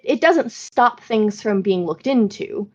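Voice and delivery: normal talking voice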